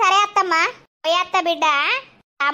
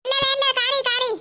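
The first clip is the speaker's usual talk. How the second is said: mocking imitation of her; 7 semitones higher than usual. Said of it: "కానీ అంటది".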